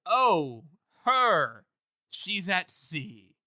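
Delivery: slow, halting, loud cartoonish fibbing; unconvincing liar. He covers for Ida's whereabouts, hammed-up bad lying. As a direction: stall between each phrase like a bad liar. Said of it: "sounds like a Scooby-Doo character trying not to lie".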